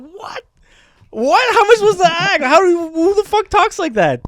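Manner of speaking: high, loud disbelieving cries; incredulous. Retold or imitated. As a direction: shriek it out in outraged disbelief. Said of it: "I was like".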